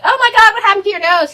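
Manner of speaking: high startled gasp of a line; mock shock. Mom shrieking at the son's broken nose.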